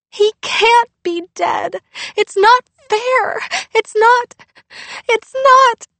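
high, loud, slow wailing protest; crying out. Acted. Girl pushes her dad away, crying it's unfair. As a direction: wail it through tears. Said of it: "she cried".

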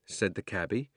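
usual speaking voice

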